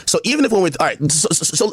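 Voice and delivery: usual speaking voice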